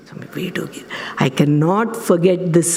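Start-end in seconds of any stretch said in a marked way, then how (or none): 0.1-1.0 soft breathy whisper of a plea; gentle whisper. Retold or imitated. Swami whispering to the elephant to let go, gently. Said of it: "But she's gently".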